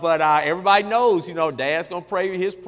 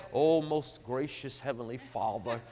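low, hushed, slow mock-pious prayer; putting on airs; 7 semitones lower, 9 dB quieter, slower than usual. Preacher mocking lofty prayer to God, putting on airs. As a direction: intone it slowly, deep and grand. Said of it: "I don't put on no airs".

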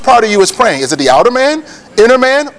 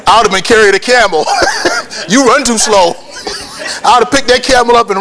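laughing joke; 4 semitones higher than usual. Pretend. Joking about fleeing, laughs mid-line.

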